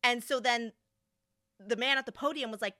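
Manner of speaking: ordinary talk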